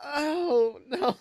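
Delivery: ordinary delivery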